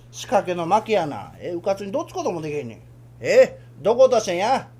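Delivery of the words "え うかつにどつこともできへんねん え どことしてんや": normal talking voice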